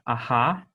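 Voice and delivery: "aha" with a breathy H; demo of H. Shown as a demonstration. Teacher to students, sounding out the H. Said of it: "just an H sound".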